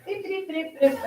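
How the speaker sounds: high beeping noises, imitated; bomb timer beeps. Shown as a demonstration. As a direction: make the beep beep of a timer. Shows the card being scanned.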